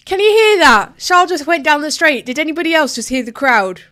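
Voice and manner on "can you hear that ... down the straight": high and loud, thrilled by the crowd's roar; excited. Real, not acted. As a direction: blurt it out over the roar of the crowd. Crowd roars as car passes.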